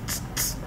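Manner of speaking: voiceless tss tss, very soft; soft hissing to show the final T; whispered tss. Shown as a demonstration. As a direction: hiss tss like calling a puppy. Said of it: "tem esse som de tss".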